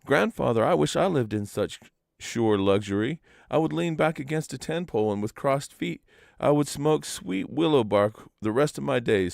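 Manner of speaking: dreamy, envious sigh of a youngster; wistful sighing. A narrator's reading. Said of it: "sighed Manston".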